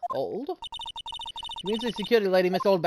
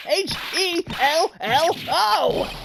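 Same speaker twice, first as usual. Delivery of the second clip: spelled-out call; 9 semitones higher, 4 dB louder, much slower than usual. Acted. Shouting at ignoring character.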